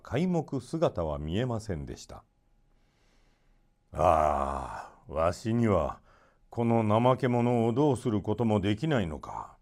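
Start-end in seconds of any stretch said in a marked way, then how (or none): 3.9-9.6 lamenting father. A narrator's reading. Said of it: "ムスタフは嘆きました".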